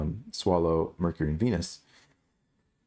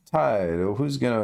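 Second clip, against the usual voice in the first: ordinary delivery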